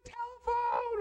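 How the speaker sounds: high yell across the house calling a name; shrill-pitched call up the stairs, held out long; kids yelling. Retold or imitated. Kid yelling to a sibling that the phone is for them, high call. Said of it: "Or very rarely".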